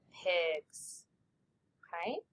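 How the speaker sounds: normal talking voice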